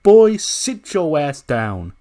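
loud, drawn-out put-down; slowly and loudly put him in his place; commanding, stretched-out words; loud rebuke. Acted. Roman dismisses a challenger, acted.

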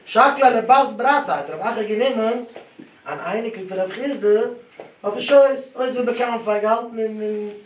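loud, high-pitched teacher reciting the passage; emphatic text recitation. Acted.

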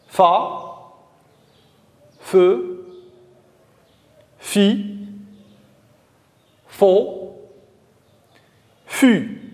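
each of "Fa, feu, fi, fo, fu" a separate, distinct syllable; reading syllables aloud. Shown as a demonstration. Teacher leads pupils reading syllables together, separated syllable drill. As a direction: say "Fa, feu, fi, fo, fu" one by one, long pauses between. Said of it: "Lisons ensemble les syllabes suivantes".

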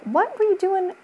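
high, critical mock snap at her husband; imitating an irritated wife catching him idle; wife nags husband watching TV, high and annoyed. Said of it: "she was annoyed".

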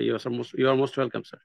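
ordinary delivery

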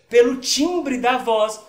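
slow down and hit "timbre" hard; teacher spelling out "pelo timbre da voz"; stress on timbre. Shown as a demonstration. Teacher stressing key term.

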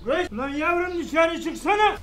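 loud, slow call; calling out loudly. Real, not acted. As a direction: call out across the yard. Calls child outside.

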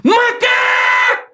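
very high, long-drawn scream; loud tic shout. Shown as a demonstration. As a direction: yell it out high and stretch it. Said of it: "Só que é alto, tá ligado?".

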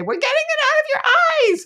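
very high, fast mock parent squeak; squeaky voice. Mom imitates parents as toddler hears them, very high fast squeak. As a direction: parody grown-ups from a toddler's view. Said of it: "and say".

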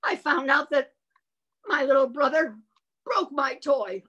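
high loud mock anger; loud hammed-up fury; furious. Shown as a demonstration. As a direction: act out a furious child.

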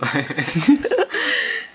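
burst of laughter. Real, not acted. Laughing at the scene.

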